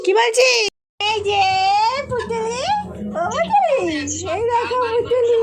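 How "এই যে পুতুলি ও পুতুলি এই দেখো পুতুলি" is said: put-on baby talk. Calling to a baby cousin.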